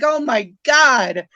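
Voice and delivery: hammed-up astonished shout; amazed exclaim. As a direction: blurt it out in disbelief. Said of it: "And I'm like".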